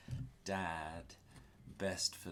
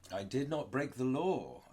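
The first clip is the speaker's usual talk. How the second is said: loud denial; 6 semitones higher, 8 dB louder than usual. Acted. Defiant denial at press conference.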